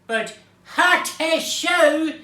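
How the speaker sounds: loud, drawn-out mock sneeze; huge sneeze. Storyteller voicing Mister Noisy's sneeze, huge and loud. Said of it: "Mister Noisy sneezes".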